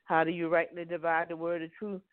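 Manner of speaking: usual speaking voice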